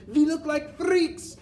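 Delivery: high, loud, horrified exclamation; hammed-up high-pitched shout; child's shout. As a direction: squeal it like an appalled child. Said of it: "Another shouts".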